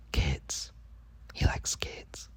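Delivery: whispered, voiceless aside. Real, not acted. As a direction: drop to a secret whisper.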